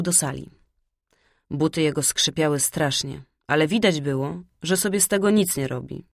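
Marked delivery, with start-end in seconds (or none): none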